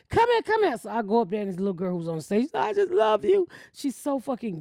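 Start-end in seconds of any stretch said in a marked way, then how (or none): 0.1-0.8 high sing-song call to mama; a daughter waving her mother over, high and eager. Retold or imitated. Daughter calling again. 2.5-3.5 gushing fan. Retold or imitated. Singer gushing onstage.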